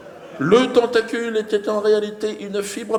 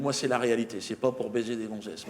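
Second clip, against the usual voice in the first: lower, quieter friend's voice; serious friend; 7 semitones lower, 7 dB quieter than usual. Retold or imitated. Friend rejecting a Raël comparison, low and serious. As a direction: insist it is real, no joke.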